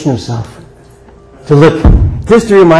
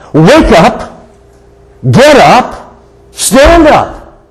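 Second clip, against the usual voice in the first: punchy calls climbing higher each time; rousing call; 7 semitones higher, much slower than usual. Acted. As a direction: rouse a dozing congregation, pitch rising sharply. Urgent message to dozing church.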